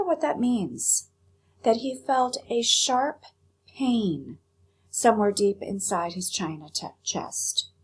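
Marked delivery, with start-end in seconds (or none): none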